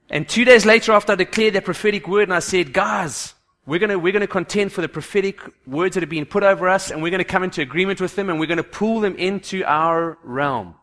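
loud, rousing preacher; preaching. Acted. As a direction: preach it with full fire. Preaching at full force.

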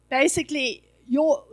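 ordinary talk, nothing marked

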